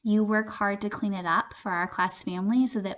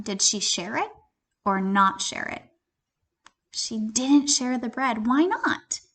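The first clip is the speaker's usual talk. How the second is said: usual speaking voice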